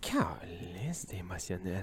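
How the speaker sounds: slow, breathy whispered aside. Real, not acted. Host joking on the side, in a slow whisper. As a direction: whisper the joke under your breath. Aside joke under co-host's talk.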